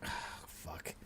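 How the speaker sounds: sighed, barely voiced mock dismay; low, under-the-breath reluctant warning; hushed dismay. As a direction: imitate a coach quietly advising retreat. Imagined coaches' dismayed quiet reaction.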